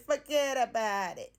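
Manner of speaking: deliberately terrible New York accent, playful and slow; bad New York accent. Shown as a demonstration. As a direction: do a cheesy New York accent on Forget about it, stretched out. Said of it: "That is the worst New York accent ever".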